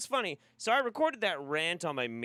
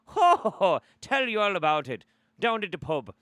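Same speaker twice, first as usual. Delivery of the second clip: loud, high stage-Irish pub cheer; Irish accent; 7 semitones higher, 9 dB louder than usual. Acted. Host showing off his accent, loud hammed-up Irish. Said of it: "perfect Irish accent".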